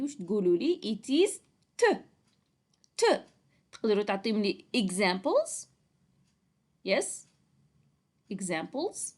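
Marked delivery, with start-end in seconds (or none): 1.8-3.1 T said as its short sound, a puff; letter sound of T. Shown as a demonstration. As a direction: sound out T, not its name. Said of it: "what's the sound of letter T".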